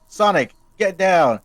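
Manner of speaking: loud, slow soldier's order; commanding warning. Acted. Chris warning Sonic from the shadows, loud and slow. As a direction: bark the warning from the dark.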